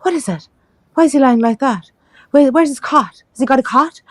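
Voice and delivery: put-on high, astonished nurse voice, no Irish accent; shocked midwife. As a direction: pitch up as the baffled visiting midwife. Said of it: "She was Irish. I could do her accent, but I won't".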